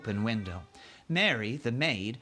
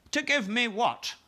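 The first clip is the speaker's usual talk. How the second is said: queen voice; 10 semitones higher than usual. Acted. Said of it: "the Queen said".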